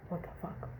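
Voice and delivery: breathless, barely voiced whisper; shocked whisper. Real, not acted. Stunned by the wife's accusation, a soft unvoiced whisper. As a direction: let it slip out under your breath.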